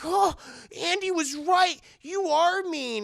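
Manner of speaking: put-on high, loud, slowing kid voice; hurt child. Tyler turning on Chucky, hurt child. Boy realizes Chucky is mean.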